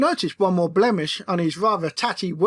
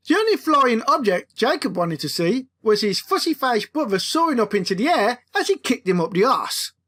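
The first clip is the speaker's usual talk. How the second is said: ordinary delivery, nothing marked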